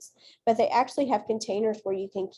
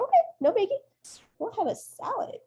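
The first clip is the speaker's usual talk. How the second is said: high, airy no-big-deal voice; breezy okay; 12 semitones higher than usual. Retold or imitated. Teacher humoring her colleagues about salad, high and breezy. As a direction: brush it off lightly. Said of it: "I was like".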